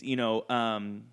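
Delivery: ordinary talk, nothing marked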